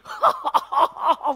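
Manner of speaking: loud, high acted belly laugh; hearty laugh. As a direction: burst out laughing as Jesus. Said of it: "이렇게 웃으시는 거예요". (크게 터뜨리는 웃음소리)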